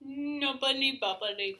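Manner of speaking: drawn out slowly, relishing each syllable; slow savouring. Shown as a demonstration. Repeating the phrase slowly because it is fun to say. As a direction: roll knobbly bobbly slowly round the mouth. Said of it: "really fun to say".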